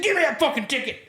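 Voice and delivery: high, gleeful demand; snatch it back with delight; the cop taking back the ticket, thrilled and high; excited demand. Retold or imitated.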